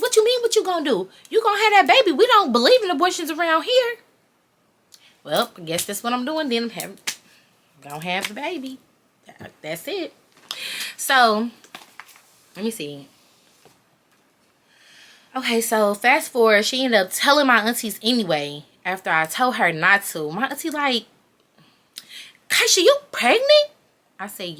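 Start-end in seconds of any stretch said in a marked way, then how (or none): 0.0-4.0 very high, forceful mom voice; mock indignant mom. Mom telling her daughter what she'll do, high-pitched indignation. Mom retold laying down the law. 22.5-23.7 very high, loud nosy auntie; mock shocked auntie. Auntie asking in front of the whole family, loud high-pitched shock.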